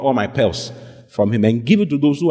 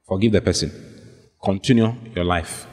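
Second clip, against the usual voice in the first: soothing pastor; 6 semitones lower than usual. Retold or imitated. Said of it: "I said".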